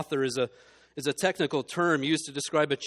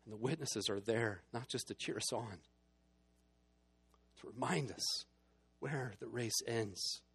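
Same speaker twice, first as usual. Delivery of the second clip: tender hush; 5 semitones lower, 9 dB quieter than usual. Acted. Preacher quietly comforting the church about home, tender hushed voice. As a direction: draw them in gently, almost confiding.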